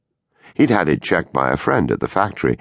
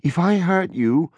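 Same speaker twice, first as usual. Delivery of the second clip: high, gentle and careful; 11 semitones higher than usual. A narrator's reading. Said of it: "Larry said very carefully".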